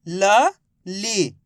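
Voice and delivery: ल, ली said slowly and apart; teacher building the word letter by letter; sounding out letters. Shown as a demonstration. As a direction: sound out ल then ली. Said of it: "ला में छोटी की मात्रा ली".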